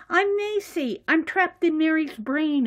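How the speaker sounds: high-pitched little character voice; plaintive introduction, pitched well up; Little Miss Macy. Acted. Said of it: "she goes".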